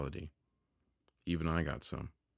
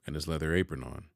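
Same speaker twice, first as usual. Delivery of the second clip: ordinary delivery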